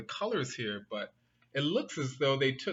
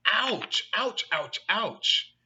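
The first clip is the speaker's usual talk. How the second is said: hammed-up ouches, higher and louder; mock pain; 5 semitones higher, 5 dB louder, slower than usual. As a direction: yelp like a stung cub for children. Dex stung by bees.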